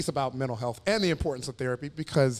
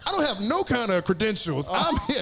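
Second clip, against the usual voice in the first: mock pastor; 7 semitones higher than usual. Pastor voice retold, laughing.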